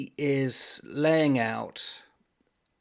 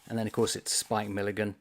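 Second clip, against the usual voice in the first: ordinary talk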